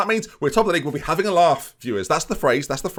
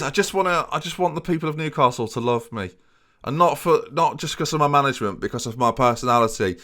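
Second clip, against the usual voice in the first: normal talking voice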